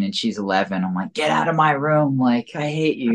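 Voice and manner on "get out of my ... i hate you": higher, mock-bratty "get out" at a little sister; bratty teen yell. Teen brother to little sister, mock bratty higher yell. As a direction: replay a thirteen-year-old snapping at his sister.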